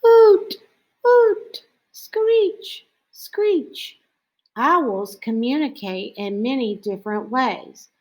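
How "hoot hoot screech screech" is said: high, loud hooting owl calls then a high screech. Retold or imitated. Reading owls to children, high owl hoots and screeches.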